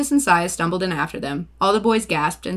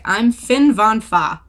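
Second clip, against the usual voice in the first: put-on German accent; 4 semitones higher, 7 dB louder, much slower than usual. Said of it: "German accent".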